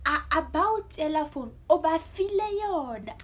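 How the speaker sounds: high, loud retold telling-off; imitated dad's scolding. Dad siding with mom against the child, mock scolding. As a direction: play dad joining in the scolding. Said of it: "siding with her".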